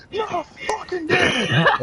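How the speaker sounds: high, loud, drawn-out cursing; mock outburst of screamed frustration; yelled curse. Realizing his cigarette is lit indoors, high slow yelled curse.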